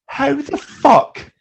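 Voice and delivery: high, loud, stretched-out incredulity; exasperated disbelief. Retold or imitated. Reacting to a government health statement, exasperated disbelief. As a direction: blurt it slowly, utterly baffled.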